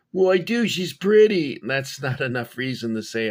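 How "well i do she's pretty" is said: put-on teen self insisting. Teen self answers mom back.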